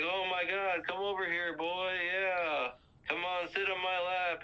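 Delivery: very pleased. Retold or imitated. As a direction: imitate Santa happily inviting someone over. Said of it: "like, very pleased".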